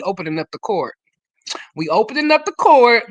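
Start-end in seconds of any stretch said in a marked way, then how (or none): 1.8-3.0 louder and higher, proclaiming it; loud announcement. Real, not acted. Host opening court for the group, a loud proclamation. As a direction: call it out so the whole room hears.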